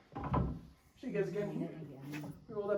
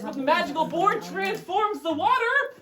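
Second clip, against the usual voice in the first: slow, loud showman build; magical reveal; 6 semitones higher, 8 dB louder, much slower than usual. Acted. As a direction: hype it like a magic trick.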